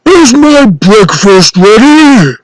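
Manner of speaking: slow giant bellow; giant's yell. Acted. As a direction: bellow down the castle for breakfast. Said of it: "the giant would yell".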